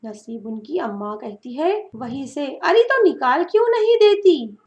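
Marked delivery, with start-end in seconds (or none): 2.4-4.6 high, loud, impatient call from inside; impatient shout. Acted. Old mother shouting impatiently at her daughter from inside. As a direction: snap back the obvious.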